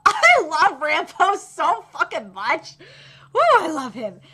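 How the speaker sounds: loud, slow adoring squeal; loud slow gushing love; gushing fangirl. Real, not acted. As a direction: gush over your favourite character. Fangirling over favourite character.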